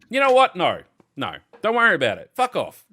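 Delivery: loud, high, fed-up dismissal; angry outburst. Acted. Seller calling off the sale to demanding buyers, angry. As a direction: lose patience and send them away.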